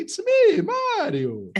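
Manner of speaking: high, loud cheery Italian plumber; squeaky excited catchphrase, laughing; Mario voice. Retold or imitated. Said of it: "ele se apresentando pra você".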